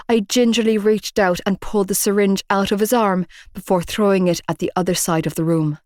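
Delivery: ordinary delivery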